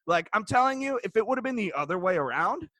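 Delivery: normal talking voice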